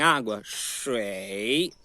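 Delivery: "shui" very slow, dipping down and up; textbook third tone. Shown as a demonstration. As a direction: draw "shui" down and back up slowly. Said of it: "Do tipo assim". ("shui" devagar, desce e sobe)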